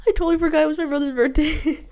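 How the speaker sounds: high, loud burst through laughter; laughing confession. Real, not acted. Vlogger admits forgetting the brother's birthday, laughing. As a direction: blurt it out while laughing.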